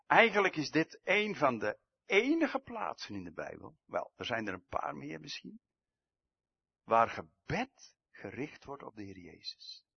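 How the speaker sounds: ordinary delivery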